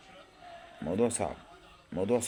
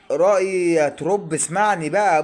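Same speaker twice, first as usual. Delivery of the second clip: loud pleading; 6 semitones higher, 5 dB louder than usual. Real, not acted. Fan pleading loudly with coach.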